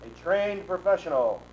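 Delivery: grand, slow, booming ringmaster; grandiose flea circus showman. Acted. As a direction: savour each word like a showman. Retake of the showman line.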